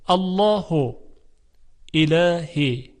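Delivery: الله recited with full Arabic sounds; Quranic recitation. Shown as a demonstration. (تلفظ قرآنی الله)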